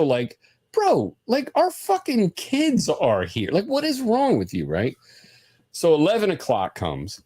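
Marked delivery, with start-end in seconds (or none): 0.7-4.7 high, disbelieving protest; retold indignation, pitched way up; exasperated rebuke. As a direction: plead for some sense, appalled. Retells rebuking his brother-in-law.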